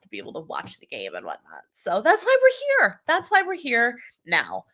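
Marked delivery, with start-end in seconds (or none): 1.7-3.0 upbeat. Real, not acted. Wrapping up explanation.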